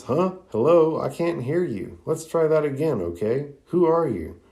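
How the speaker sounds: acted pushy girl, pitch jumping high; chest-out bossy. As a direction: puff up and demand an answer. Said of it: "chest out responds".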